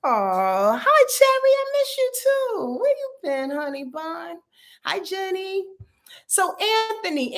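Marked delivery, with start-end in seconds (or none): 0.0-5.6 much higher, gushing hello; high sugary greeting to a friend; sweet greeting. Real, not acted.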